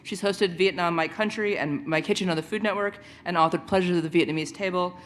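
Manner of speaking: ordinary talk, nothing marked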